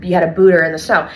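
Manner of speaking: ordinary talk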